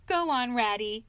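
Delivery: ordinary delivery, nothing marked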